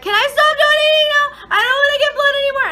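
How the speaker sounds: very high, loud panicked plea; hammed-up shrieked pleading; retold freaking out. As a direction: beg frantically, imitating a panic attack. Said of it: "And I'm, like, freaking out".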